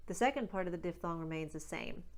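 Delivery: normal talking voice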